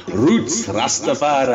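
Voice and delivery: slow, put-on Rasta-style voice. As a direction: drawl it out like a Rastafarian. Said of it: "hang on, what should I do?".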